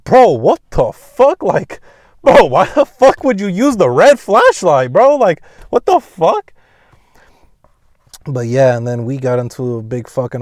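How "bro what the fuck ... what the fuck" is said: high, loud exasperated protest; acted outraged disbelief. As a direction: re-enact yelling at dad in disbelief. Acted outrage at dad over flashlight.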